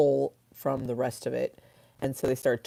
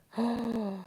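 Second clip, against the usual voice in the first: mock gasp; 8 semitones higher, 5 dB quieter than usual. Mock shock at never dreaming.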